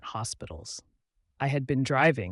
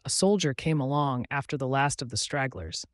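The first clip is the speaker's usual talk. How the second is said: usual speaking voice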